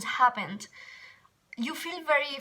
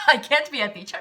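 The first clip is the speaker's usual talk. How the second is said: laughing in disbelief; 7 dB louder, faster than usual. Retold or imitated. Retelling an insulting remark, laughing.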